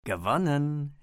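triumphierend, mit Grinsen gesagt; grinsend. A narrator's reading. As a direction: den Sieg genüsslich verkünden. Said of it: "stellte er grinsend fest". (grinsend triumphierend)

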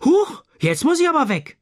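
high, loud, startled exclamation; startled cry. A narrator's reading. Mother startled in the kitchen, calling out high and loud. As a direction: yelp it out in sudden alarm. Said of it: "Die Mutter ruft erschrocken".

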